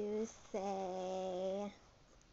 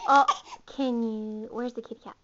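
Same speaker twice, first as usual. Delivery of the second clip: usual speaking voice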